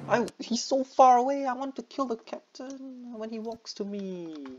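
very high, drawn-out complaint; frustrated whine. Real, not acted. Player complaining about auto-aiming at the wrong guy, frustrated whine. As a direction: wail it slowly at a stubborn game.

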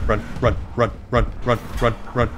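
urgent repeated run; panicked urging. Real, not acted. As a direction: flee in a panic. Fleeing a monster in game.

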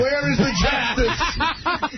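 high, slow, hammed-up indignation; mock outrage. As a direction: cry out to the heavens in jest.

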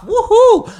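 high, loud whoop of triumph; cheer. Real, not acted. Proud of not flinching, a high loud whoop. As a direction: whoop like you just won. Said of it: "proud of myself here".

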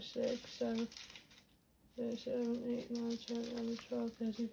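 counting under breath. Real, not acted.

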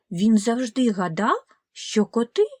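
plain reading voice

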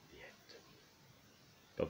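whispered the end; whispering. A narrator's reading. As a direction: whisper it as they sleep. Bedtime story closing.

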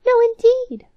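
high, loud No, indeed; indignant cry. Acted. Cropped-eared mouse cries out at her sister, high and loud. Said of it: "cried the mouse with the cropped ears".

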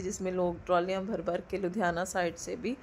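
ordinary talk, nothing marked

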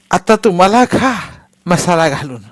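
loud, high, slow furious yell; furious shout. A narrator's reading. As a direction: snap back at the old woman in rage. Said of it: "राधी एकदम संतापून ओरडली".

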